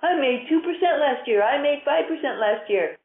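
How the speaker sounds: mock delighted investor. Mocking pleased small investor.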